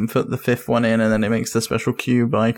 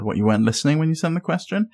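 usual speaking voice

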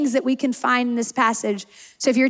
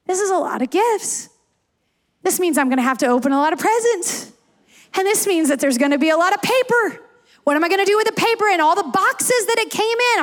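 put-on higher-pitched, fretting little boy; mock upset child; 4 semitones higher, faster than usual. Little boy fretting to psychologist over too many gifts, mock upset. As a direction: pile up the worries in a hammed-up, upset rush. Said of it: "he immediately started getting upset".

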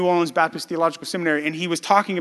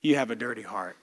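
much softer, lower, kind pastor's answer; gracious gentle reply; 7 semitones lower, 7 dB quieter than usual. Retold or imitated. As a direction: ease the man's shame softly. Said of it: "so graciously just smiled and said".

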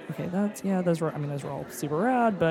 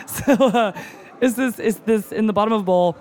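laughing through the words, louder; 4 semitones higher, 5 dB louder than usual. Real, not acted. Caught doing canal arms, laughing while talking. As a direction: giggle while explaining.

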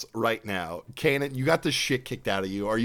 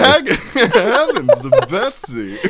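laughing, higher, louder cartoon lion; hammed-up slow Snagglepuss with a laugh; 10 semitones higher, 9 dB louder, slower than usual.

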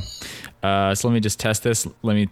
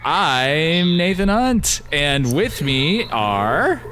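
announcer voice; 5 semitones higher, much slower than usual. Acted. Slow announcer introduction.

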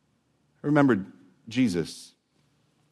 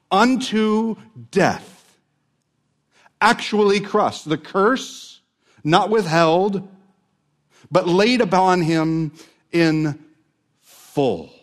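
building preaching; 8 semitones higher, 4 dB louder, slower than usual. Real, not acted.

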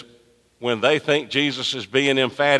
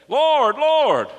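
mock pleading cry; 3 dB louder than usual. Mimicking the pleading crowd.

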